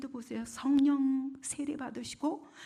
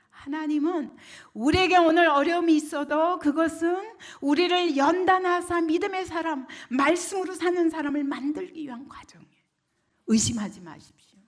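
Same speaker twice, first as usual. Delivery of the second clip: high, loud sermon proclamation; impassioned preaching; 7 semitones higher, 9 dB louder than usual. Real, not acted. Preacher declares Christ's finished work, impassioned and raised. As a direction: drive each clause home to the congregation.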